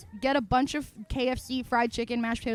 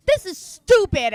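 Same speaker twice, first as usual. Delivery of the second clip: loud, high, slow angry pout; mad kid; 7 semitones higher, 10 dB louder, slower than usual. Retold or imitated. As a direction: stomp the words out like a furious child. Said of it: "And I was really mad".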